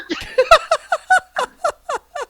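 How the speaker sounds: squealing burst of laughter; high laughing fit. Real, not acted. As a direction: lose it again. Laughing fit mid-story.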